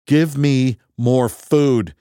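slow, loud hungry demand. Acted. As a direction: insist on food, drawn out. Demands food from computer.

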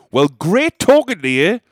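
loud, slow, pointed goodbye; loud aggressive farewell to the drunk lady. Retold or imitated. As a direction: say it loudly to end the awkwardness. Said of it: "I just said very loudly".

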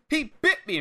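high-pitched indignant tattling; mock complaint. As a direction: imitate crying foul to the ref. Retold complaint to the referee.

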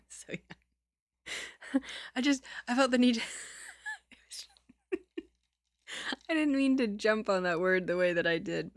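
can't stop laughing; real laughter while explaining. Real, not acted. Reader laughing at innuendo.

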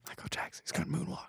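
whisper. Real, not acted. Joking whisper.

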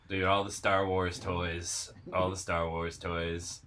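low, soft, dreamy reverie; mock-reverent hush; hushed nostalgia. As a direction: sigh it like a fond memory. Wistful toy-store nostalgia.

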